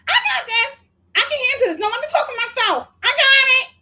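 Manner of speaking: high-pitched mimicry of brushing off help; mock defiant insistence. Host mimicking someone refusing help, high mock insistence. Mimics defensive exchange in retold quote.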